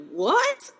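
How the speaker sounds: high-pitched mock "what?"; retold surprise. Reader discovering the fairy tale link, mock surprise. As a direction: act out delighted disbelief, voice shooting up. Acts out own reaction while reading.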